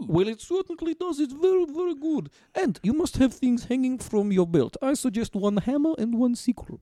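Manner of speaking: lightly voiced Soviet dictator with a vague accent; Joseph Stalin. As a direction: play an accented dictator as fashion expert. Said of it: "Joseph Stalin here".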